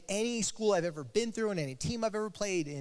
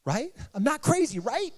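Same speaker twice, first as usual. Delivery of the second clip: tearful plea; 9 semitones higher, 5 dB louder than usual. Retold or imitated. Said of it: "with tears coming down her eyes".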